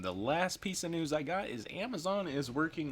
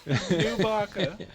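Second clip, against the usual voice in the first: drawn-out amused; 8 semitones higher, 5 dB louder, much slower than usual. Real, not acted. Savoring the silly name.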